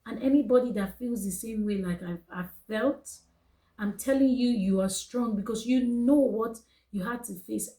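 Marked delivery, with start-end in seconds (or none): none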